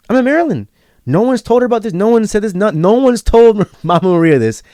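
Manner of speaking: high-pitched amazed insistence; astonished, emphatic protest; incredulous. Real, not acted. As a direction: stress how impossible it is, voice climbing high. Amazed nobody had told her.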